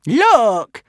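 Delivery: high, loud call for attention; Barbara the Barbarian. A narrator's reading. Barbarian woman interrupts the crowd, calling loud and high. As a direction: call out to make them look. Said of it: "said Barbara the Barbarian".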